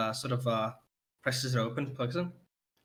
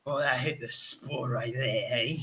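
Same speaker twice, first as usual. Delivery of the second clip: put-on slow drunk British accent. Drunk man praises the ale in a British accent. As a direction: savour it like a tipsy British drinker. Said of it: "Why the fuck is he British now".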